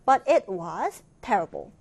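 very slow, clear reading of the English sentence; slow, careful classroom read-aloud; slow model reading. Shown as a demonstration. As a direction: read it slowly for learners to follow. Teacher reads model sentence.

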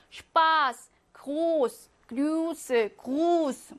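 슈파스, 그로스, 그루스 each with a stretched vowel; long vowels. Shown as a demonstration. Teacher reading examples, long vowels in 슈파스, 그로스, 그루스. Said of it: "무조건 길게".

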